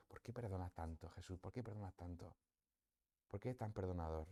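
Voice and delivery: soft, hushed questioning of Jesus; intimate prayer. Acted. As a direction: wonder softly, as if praying alone.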